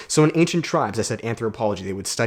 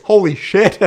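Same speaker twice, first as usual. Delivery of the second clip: sudden high loud outburst; holy shit exclamation; 7 semitones higher, 9 dB louder, slower than usual. Real, not acted. Recalling moving out alone, real loud surprised outburst. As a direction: blurt it out, real astonishment.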